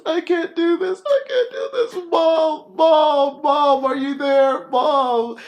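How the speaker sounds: high, loud sobbing plea for mom; crying panic. Retold or imitated. Retelling the film, daughter crying out for her mother. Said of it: "she says".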